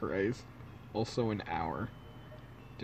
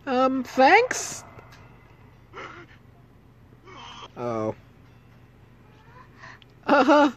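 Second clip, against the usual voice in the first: put-on very high, loud girl voice; nervous hopeful girl; 12 semitones higher, 6 dB louder, slower than usual.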